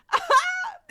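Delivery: very high, loud squealing laugh; shrieking laugh. Real, not acted.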